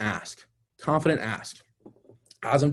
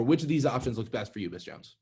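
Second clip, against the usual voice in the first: confident closing question that falls away on "Miss Jones"; falling tune; 2 semitones lower, 3 dB quieter than usual. Shown as a demonstration. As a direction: let the voice drop on Miss Jones, no rise at the end. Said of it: "my voice doesn't go up, it goes down".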